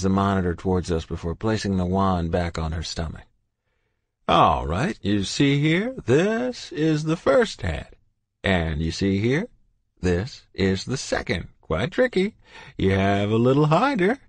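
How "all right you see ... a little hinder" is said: put-on jovial old doctor, booming hello; kindly old doctor voice; cheery doctor. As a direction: greet them like a beaming family doctor. Doctor shows the scan.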